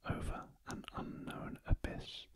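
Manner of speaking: ordinary delivery, nothing marked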